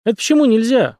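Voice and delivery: taken aback, pitch jumping up; surprised question. A narrator's reading. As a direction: ask it in open surprise. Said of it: "удивился таксист".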